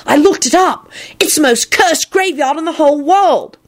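put-on high, insistent child voice; alarmed Tim. A narrator's reading. Tim warning of the cursed graveyard, alarmed.

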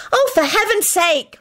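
high, drawn-out yell; exasperated yell. A narrator's reading. Hermione losing patience with baffled Lupin, yelling. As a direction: yell it slowly, pitched high. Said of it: "Hermione yelled".

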